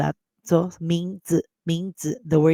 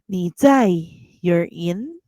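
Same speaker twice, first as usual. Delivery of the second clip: normal talking voice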